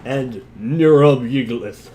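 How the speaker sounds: slow, loud pronouncement of the name; dramatic monster name. Acted. Reader announcing the monster's name, slow and ominous. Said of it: "Please finish the sentence".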